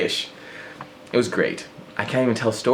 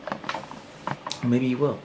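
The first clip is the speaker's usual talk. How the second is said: sly tease; 7 dB quieter, much slower than usual. Pretend. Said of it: "teasing aside".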